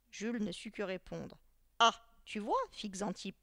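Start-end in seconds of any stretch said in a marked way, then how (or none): 1.8-2.6 loud triumphant little exclamation; very satisfied. A narrator's reading. Teacher catches his pupil without an answer, satisfied. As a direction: score the point with satisfaction. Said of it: "très satisfait".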